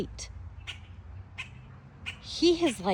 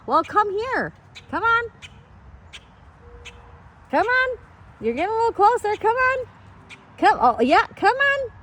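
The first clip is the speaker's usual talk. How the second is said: high coaxing call, louder; high sing-song coaxing; coaxing pet voice; 8 semitones higher, 4 dB louder than usual. Real, not acted. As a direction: sweet-talk the bird down. Coaxing the bird sweetly.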